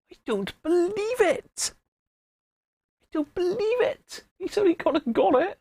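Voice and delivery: very high, astonished exclamations; squeaky mock-astonished outburst; incredulous disbelief. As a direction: play up the disbelief. Disbelief over Keith's winning guess.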